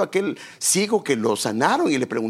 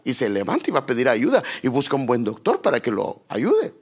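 ordinary delivery

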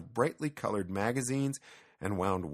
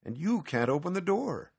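higher-pitched objection; protesting; 9 semitones higher than usual. Acted. Petson tells the cat the attic is out of reach, protesting. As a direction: push back, pitch lifted in protest. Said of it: "Petson protested".

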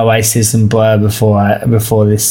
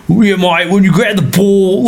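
higher, hammed-up Australian rugby league bloke; rugby league Aussie accent; 7 semitones higher than usual. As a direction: play the broad Aussie footy fan. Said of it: "there's a rugby league accent".

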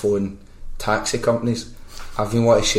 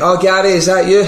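high, louder delighted recognition; imitated woman; 9 semitones higher, 6 dB louder than usual. Woman answering a blagged call, imitated high and eager. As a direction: put on a woman's higher phone voice. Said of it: "And she went".

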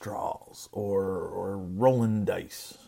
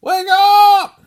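frantic wake-up shout; 13 dB louder, much slower than usual. Acted. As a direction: yell much higher and louder, as if shaking him awake. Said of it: "I imagine that going something like".

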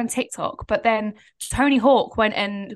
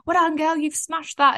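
high-pitched mock congratulations; raised-pitch imitated praise; imitating cheering onlookers; 7 semitones higher, 3 dB louder than usual. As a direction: cheer her on like a passer-by. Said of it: "And they're going".

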